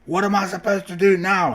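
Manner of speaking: high, loud frantic yell. A narrator's reading. Ringmaster yelling at the broken-down train. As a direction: yell it out in a panic. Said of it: "he could hear the ringmaster yelling".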